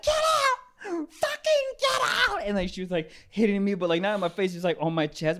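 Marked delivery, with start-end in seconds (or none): 0.0-2.4 mock furious yell. Ex-girlfriend hitting his chest, high crying yell. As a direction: shriek at him through tears. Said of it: "'cause you're like".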